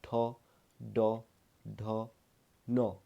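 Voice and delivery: ठ, ड, ढ, न each said alone, tongue curled back to the roof of the mouth; retroflex letter demo. Shown as a demonstration. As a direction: sound out ठ, ड, ढ, न slowly, one by one, as a teacher. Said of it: "जैसे मैं ट कहूँ".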